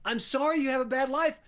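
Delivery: high-pitched, unsympathetic online commenter; mock commenter retort. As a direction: imitate fed-up commenters dismissing a sob story. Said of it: "the response in the, you know, in the, in the comments".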